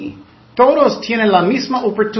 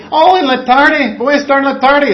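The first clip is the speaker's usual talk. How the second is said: much higher, louder hammed-up promise; mocking empty promise; 8 semitones higher, 8 dB louder than usual. Preacher to congregation, parodying liars' excuses, high and loud. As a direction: overplay a cheerful promise you won't keep.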